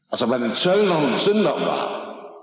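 ordinary delivery

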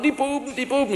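high mock wail of a child; imitated upset little daughter. Father imitates upset daughter blaming brothers.

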